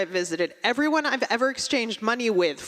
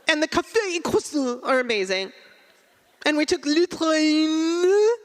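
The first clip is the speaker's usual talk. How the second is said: hammed-up fake French accent; 6 semitones higher than usual. Friend from Waltham gushes about Paris in fake French accent. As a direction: gush over Paris in an overdone French accent. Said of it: "She goes".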